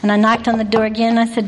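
ordinary talk

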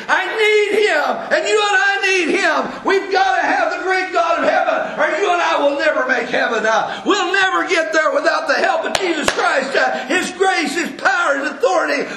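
high ringing preacher's cry; fervent preaching. Acted. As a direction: call it out to the whole church. Preaching at full force.

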